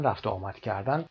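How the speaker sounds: usual speaking voice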